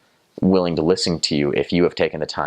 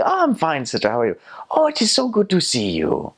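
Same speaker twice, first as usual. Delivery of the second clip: high-pitched, effusive Zimbabwean accent; imitated Zimbabwean accent, pitched way up; Zimbabwean English accent; 12 semitones higher than usual. Shown as a demonstration. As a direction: greet back high and delighted in Zimbabwean English. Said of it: "let's see if I can still do this".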